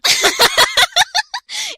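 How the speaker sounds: loud, squealing laughter; helpless high laughing. Real, not acted. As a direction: cackle at the joke. Cracking up at the reading.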